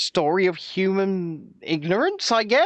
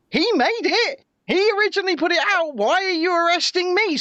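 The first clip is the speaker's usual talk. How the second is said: higher, louder, fast outraged protest; indignant protest; 10 semitones higher, 3 dB louder than usual. Retold or imitated. Lawrence Fox protesting to police from his driveway. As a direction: protest the arrest with disbelief. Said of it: "and he's going".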